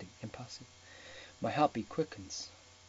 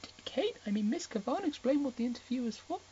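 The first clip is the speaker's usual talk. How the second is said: put-on much higher, breathy girlish voice; flustered Ana; 11 semitones higher than usual. Ana nervously asking Gray about Kate, high-pitched. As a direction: squeak out the question nervously.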